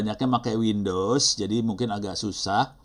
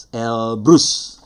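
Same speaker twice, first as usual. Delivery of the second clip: ordinary delivery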